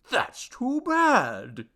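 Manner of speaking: drawn-out, high, loud sympathy; slow, over-sympathetic little god. A narrator's reading. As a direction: stretch the words in theatrical concern. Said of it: "he murmured".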